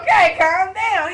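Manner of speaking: laughing. Real, not acted. Teller laughing during escape.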